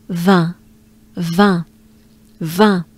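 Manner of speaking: "vin" as the French word for wine, nasal vowel. Shown as a demonstration. Teacher finishes the word, French nasal vowel. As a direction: end "vin" on the nasal, no sounded n. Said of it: "the word vin in French".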